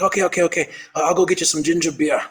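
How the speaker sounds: lightly imitated Aussie helper; quick agreement with an Australian lilt; Australian accent. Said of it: "You're Australian?".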